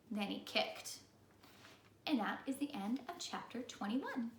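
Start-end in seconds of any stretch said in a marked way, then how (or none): none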